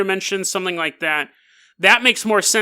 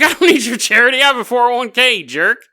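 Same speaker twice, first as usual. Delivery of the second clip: louder, higher mock indignation; old man snaps at the kids over charity, mock offended; indignant old man; 5 semitones higher, 5 dB louder than usual. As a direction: huff at them for offering charity. Offended old man refuses money.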